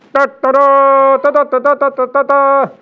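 loud, high sung trumpet fanfare. Retold or imitated. Preacher mimics a giver blowing his trumpet, loud fanfare. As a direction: toot a showy fanfare. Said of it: "blow your trumpet".

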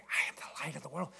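hushed unvoiced whisper of the line. Mother prompting her frozen son from the second row, whispering. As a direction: breathe the words with no voice at all. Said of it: "And she's whispering".

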